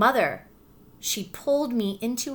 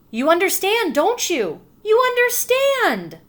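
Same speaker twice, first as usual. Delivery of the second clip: much higher, louder, slowing on the last words; excited teacher; 11 semitones higher, 5 dB louder than usual. Acted. Anne Sullivan tells her pupil she understands, excited. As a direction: burst out with delighted discovery. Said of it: "said Anne Sullivan".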